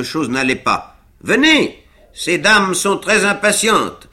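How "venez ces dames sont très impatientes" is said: high, loud cheerful call across the ice; Pickwick calling out. A narrator's reading. Pickwick calling Winkle from the ice, loud and cheerful. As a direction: hail him eagerly. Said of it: "s'écria monsieur Pickwick".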